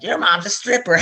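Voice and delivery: high mocking kid voice, laughing; bratty kid taunt. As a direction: taunt like a schoolyard bully. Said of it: "the other kid's like".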